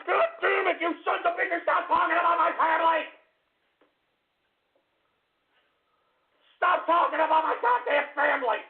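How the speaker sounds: high angry yelling; furious shouting. Real, not acted. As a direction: keep shouting in rage. Keeps screaming about family.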